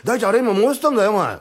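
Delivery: loud, high-pitched telling-off; scolding. Acted. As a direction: lay into him loudly. 床板の件で叱る. (大声で叱りつける)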